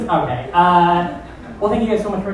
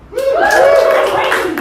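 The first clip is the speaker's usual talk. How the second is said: high, slow, loud exclamation; hyped host; 4 dB louder, slower than usual. Real, not acted. Host hyping the crowd about the fiftieth show, high and slow. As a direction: stretch it out to pump the room.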